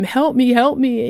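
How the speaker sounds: retold yell. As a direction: reenact yelling for help. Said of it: "I'm yelling at him".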